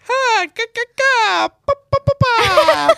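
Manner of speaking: very high squeaky helium voice. Retold or imitated. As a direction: squeak it out like you sucked a balloon. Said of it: "trying to do a helium voice".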